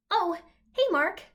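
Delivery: high, bashful squeaky greeting; bashful stutter. Acted. President greeting his visitor, bashful and high. Donald greets Mark again.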